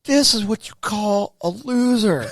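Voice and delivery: high, slow parade-ground announcement; mocking drill sergeant. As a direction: hold him up to ridicule, slowly and high. Sergeant mocks him before recruits.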